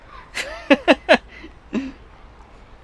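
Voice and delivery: a high, loud burst of laughter. Real, not acted. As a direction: cackle at your own joke. Laughs at own joke.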